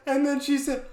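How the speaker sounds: ordinary talk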